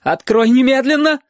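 громкий высокий крик; прикажи во весь голос. Acted. Мама кричит за дверью. (мама кричит сквозь дверь)

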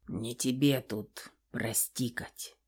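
low, soft, slow grumble; muffled grumble. A narrator's reading. Father grumbling at his daughter's apology, low and muffled. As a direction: grumble it dully under your breath. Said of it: "глухо проворчал стальной король".

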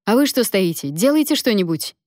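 acted agitation of a helpless father; flustered. A narrator's reading. Alen turns on the bystanders during labour, flustered and urgent. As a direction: panicky demands, opening high. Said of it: "растерялся Ален".

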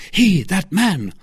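high, loud, slow exclamation; angry. Acted. D'Artagnan pointing out his enemy, angry cry. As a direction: spell it out in agitated anger. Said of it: "pale with anger".

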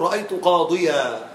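slow "قاضيا" ending on a long aa; tanween as alif. Shown as a demonstration. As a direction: stop open on "قاضيا", without an n. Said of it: "ويبدل التنوين ألفا".

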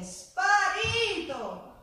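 a drawn-out, loud outcry; indignant exclamation. Acted. Abandoned woman to the audience, drawn-out loud outcry. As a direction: fling out the word in disbelief.

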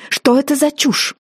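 mother-in-law scolding over nonsense; indignant outcry. A narrator's reading. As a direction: demand it, outraged. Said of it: "экспрессивно вскричала она".

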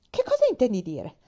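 brusque. A narrator's reading. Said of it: "L'ammalata si voltò bruscamente".

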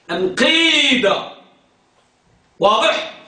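usual speaking voice

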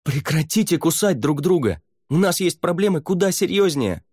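sharp high shout. Acted. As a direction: cut them off with a yell. Savier stops the quarrel.

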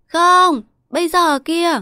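put-on very high, loud childish wail; crying child's tantrum. A narrator's reading. Boy demanding the cake right now, high bawling tantrum. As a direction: protest like a spoiled little boy. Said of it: "sốt ruột về tiếng khóc".

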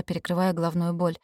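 ordinary delivery, nothing marked

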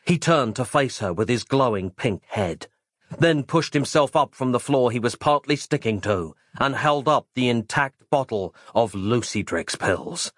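plain reading voice

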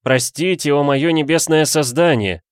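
high, loud effusive apology; startled adoration. A narrator's reading. Suitor begging his heavenly creature's pardon, startled gush. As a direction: leap in with a gushing plea. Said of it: "встрепенулся Вид".

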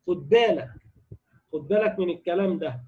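usual speaking voice

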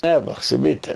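ordinary delivery

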